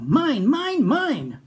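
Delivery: high, loud greedy dino roar; roaring Minosaur. Acted. Minosaur roars to everyone after wrecking toys, high and loud. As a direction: bellow it out like a spoilt dinosaur. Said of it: "he roared to one and all".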